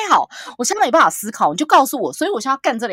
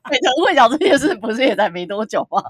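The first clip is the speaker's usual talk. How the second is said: ordinary talk, nothing marked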